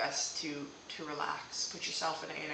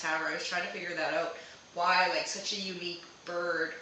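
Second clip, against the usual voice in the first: usual speaking voice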